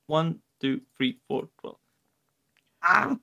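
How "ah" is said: mock dismayed cry. Character laments glasses again.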